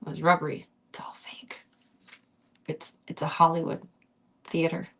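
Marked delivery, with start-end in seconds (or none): 0.9-1.6 very soft unvoiced whisper. Real, not acted. Reader joking to listeners, whisper. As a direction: breathe it as a secret.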